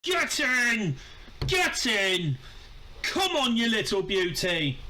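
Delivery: high-pitched ecstatic shriek; shrieking jackpot winner. Real, not acted. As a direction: scream with pure joy at a goal. Celebrating a late winning goal.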